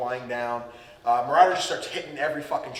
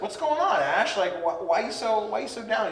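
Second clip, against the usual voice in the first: put-on higher, concerned old professor; lightly voiced Professor Oak; 7 semitones higher than usual. Professor Oak asking a sad Ash, mildly higher concern. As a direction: ask gently, pitched up. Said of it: "Professor Oak's like".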